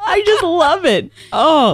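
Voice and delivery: gushing delight. Real, not acted. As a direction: gush over the sweet message. Still gushing over the call.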